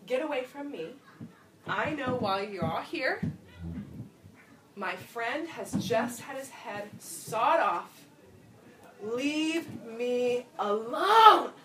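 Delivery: building to a slow, drawn-out shout on leave me alone; yelling. Pretend. Preacher as grieving Jesus yelling at the crowd, building to a slow shout. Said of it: "but I'm going to yell".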